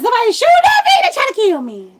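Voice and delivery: high loud yell fading into a laugh; panicked shout. Retold or imitated. Woman jumping into a stranger's car, high loud panicked yell. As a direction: blurt out in terror, then laugh.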